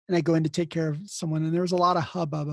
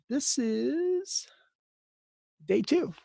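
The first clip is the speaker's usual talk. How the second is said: drawn-out, higher lead-in, then the reveal; sheepish admission; 9 semitones higher, much slower than usual. Retold or imitated. New nurse joking with the chief nurse, sheepish admission. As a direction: stall on a high stretched word before confessing.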